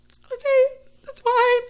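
very high, tiny mock reassurance; starstruck squeak. Fan forgiving singer who stepped on her, squeaky. Acting herself starstruck after apology.

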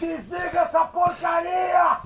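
grito irritado e mais agudo. Real, not acted. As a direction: berrando para desligarem. Manda desligar aos gritos. (grito bravo)

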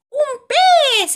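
high, loud cry of discovery; excited exclamation. A narrator's reading. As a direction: exclaim it loudly, pitch soaring. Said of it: "exclama Topolín". (exclamación aguda y entusiasmada)

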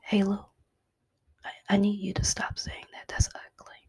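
hushed, unvoiced whisper into the ear; God's whisper. God privately correcting her for saying ugly things, whispered. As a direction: whisper a gentle correction, very softly. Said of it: "Sometimes God whispers us".

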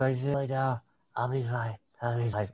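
soft, slurred tipsy mumble; fading drunk imitation, very quiet; drunk and high. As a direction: mimic someone woozy, barely audible. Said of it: "like, fully drunk and high".

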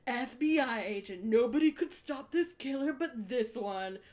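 slow dramatic trailer announcer, lightly done; dramatic announcer intro. Retold or imitated. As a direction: hype up the agent like a TV intro. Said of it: "they do this crazy intro".

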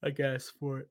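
yawning through the words; words stretched by a yawn. Real, not acted. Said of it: "I'm sorry for the yawning".

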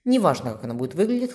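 plain reading voice

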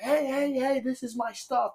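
high-pitched repeated heys; imitated protesting intruder. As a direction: mimic the guy objecting in a high voice.

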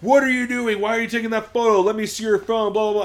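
loud, high-pitched retold tirade; imitated yelling. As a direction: imitate a man yelling angry demands. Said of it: "he starts yelling".

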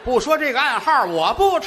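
hammed-up fussy old man; stubborn old man.

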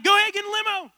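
high, loud eager host; Ty Pennington impression. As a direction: wave them in like a pumped-up host. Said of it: "Ty's like".

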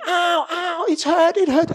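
high mock cry of pain, kept soft; mimicked scream of pain. Preacher mimics hurt wife's cry, high and soft. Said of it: "she was screaming".

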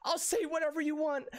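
put-on high, small child's promise; little girl pleading. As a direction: beg like a heartbroken daughter. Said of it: "she goes".

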